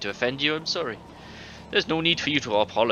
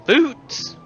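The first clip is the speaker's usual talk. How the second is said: much higher, louder amazed question; incredulous; 9 dB louder than usual. Acted. Listener amazed that he stole boots, incredulous. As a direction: squeak it out in disbelief.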